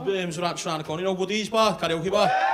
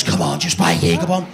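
much lower, louder camp voice; deep camp coax; 13 semitones lower, 12 dB louder than usual. Retold or imitated. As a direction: drop the voice low and push. Seller pushes.

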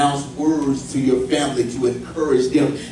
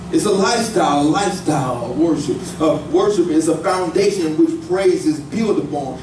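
ordinary talk, nothing marked

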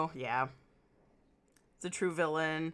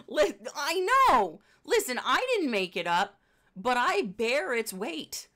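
higher, louder protest with theatrical swells; mock exasperation; 6 semitones higher, 5 dB louder than usual. Real, not acted. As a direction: plead your innocence melodramatically. Mock-dramatic defence of made-up term.

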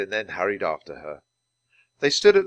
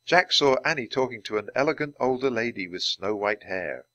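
ordinary delivery

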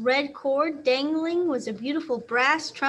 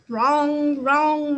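loud, ringing piano notes; loud imitation of a crashing piano; loud piano clang; 7 dB louder than usual. A narrator's reading. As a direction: bang them out like struck keys. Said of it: "wrong went the piano".

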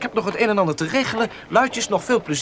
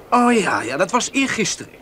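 normal talking voice

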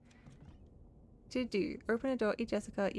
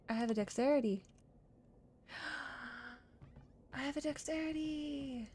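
amazed delight; 4 semitones higher than usual. Pretend. Dinosaur player gains dexterity, gasping playful delight.

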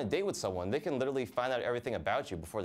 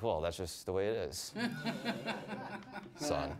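dad voice laughing; 4 dB quieter than usual. Retold or imitated.

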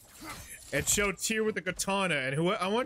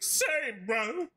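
high, slow bro drawl; hammed-up slow bro voice; voicing Helios; 5 semitones higher, much slower than usual. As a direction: play Helios as a dopey bro. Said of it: "He's like".

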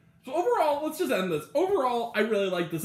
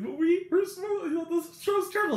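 heckling puppet voice; 10 semitones higher than usual. Retold or imitated. Old Muppet man answering the guards, high mock heckle.

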